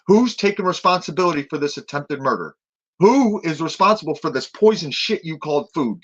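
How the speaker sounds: loud and high, hurling accusations; pretend screaming. Facing the camera after arrest, screaming accusations at the jailers. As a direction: yell every charge at the camera. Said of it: "I'm gonna start screaming".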